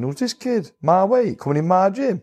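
high, mocking sneer at the newcomer; scornful inner voice. Boxer sizing up a rival in his gym, high mocking scorn.

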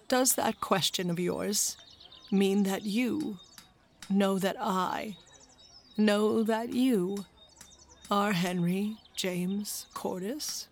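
a lightly voiced old man, soft and slow; slow old grandfather. Grandfather asking his grandson, slow and soft. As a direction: let each word land slowly and quietly, moved.

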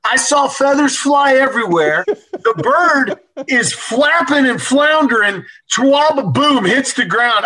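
laughing retelling. Retold or imitated. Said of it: "He said".

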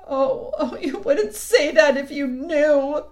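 high, slow tearful wail; between sobs. A narrator's reading. The faun contradicts Lucy's comfort, sobbing. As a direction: protest miserably through sobs. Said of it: "replied Mister Tumnus between his sobs".

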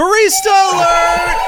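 a thrilled, piercing catchphrase call; excited shout. Acted. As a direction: yell it high and loud with glee.

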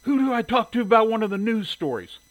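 high, loud irate caller; demanding. A narrator's reading. Shirley phoning the paper to complain, demanding. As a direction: demand to be put through. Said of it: "Shirley demanded".

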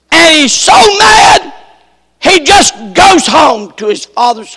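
loud, high fiery preaching; preaching at full force. Acted. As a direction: preach the rage, building and pounding. Preacher builds on Samson's rage.

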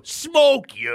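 high, strange possessed yelp; a voice that is not yours bursts out; sudden squeaky outburst. Acted. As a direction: blurt it in a sky-high alien squeak. Beard speaks through your mouth.

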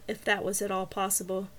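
usual speaking voice

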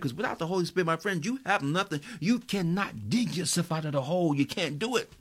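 high, loud preacher's whoop; fervent sermon build with sung prayer; preaching at full force. Real, not acted. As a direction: pour it out over the congregation.

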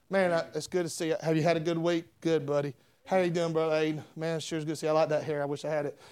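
low, soft run of small talk; casual greetings. Acted. Preacher acts out churchgoers greeting each other, low and casual.

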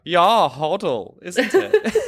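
voicing German parliament in a German accent; mock German accent. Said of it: "I didn't know you spoke German".

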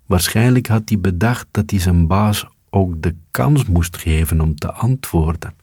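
ordinary delivery

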